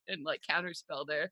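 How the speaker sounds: ordinary delivery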